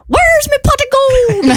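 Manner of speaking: high, hammed-up Irish leprechaun cry; Irish accent. Shown as a demonstration. Host demonstrating the Irish catchphrase for contestants. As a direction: belt it out in a stage Irish accent. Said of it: "you have to say in an Irish accent".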